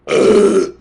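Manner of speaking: a big loud belch; loud burp. Real, not acted. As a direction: let out a hearty burp. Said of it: "Oh, that wasn't ladylike".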